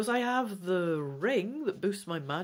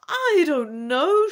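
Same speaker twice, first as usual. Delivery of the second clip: loud, higher, slow cry; 11 semitones higher, 10 dB louder, slower than usual. A narrator's reading. Rhinath cries out to Trion in panic, loud. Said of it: "she cries".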